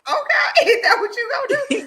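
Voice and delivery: high-pitched, laughing disbelief; mock incredulous. Friend acting out her reaction to being told to wait for money, high and incredulous. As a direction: squeal it through a laugh, mocking.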